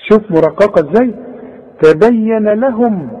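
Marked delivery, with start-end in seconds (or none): none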